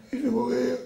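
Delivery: a panicked woman frozen on a high pole; mock terror. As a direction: squeal it like someone terrified of heights.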